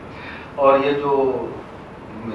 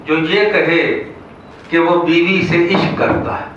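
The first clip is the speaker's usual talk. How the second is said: raised, measured mushaira declamation; comic verse recital; 5 semitones higher, 3 dB louder than usual. Acted. Poet reciting couplets about wives to an audience, declaimed. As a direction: declaim the couplet, stretching towards the punchline.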